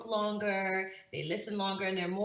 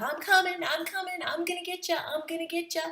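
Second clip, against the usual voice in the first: higher, lightly voiced chase call; imitating grandma; 9 semitones higher, 4 dB louder, faster than usual. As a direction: mimic grandma's chasing singsong. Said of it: "routine that my mom liked to do".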